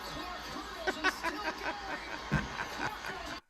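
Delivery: long high-pitched giggle fading out. Real, not acted. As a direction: giggle helplessly, high and breathy. Laughing at replay.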